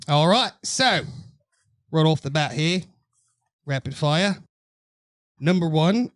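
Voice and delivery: straight-faced put-on British accent; measured fake British presenter, with pauses. Co-host opens rapid fire in a fake British accent. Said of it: "I can't do this the whole fucking episode".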